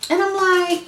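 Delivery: usual speaking voice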